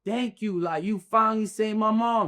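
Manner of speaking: high-voiced, retold gratitude; retold relief. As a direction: pour out thanks in a high, relieved voice. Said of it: "I'm feeling relief".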